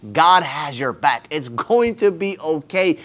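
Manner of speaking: put-on slow, soft and low, like calming a scared kid; slow tender comfort to his younger self; reassuring. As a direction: hush him gently, slowly.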